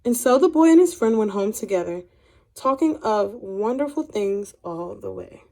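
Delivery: put-on unhurried children's story narration with gentle emphasis; reading to children. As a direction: read it like a picture book to kids, letting the pauses land. Teacher reads picture book aloud.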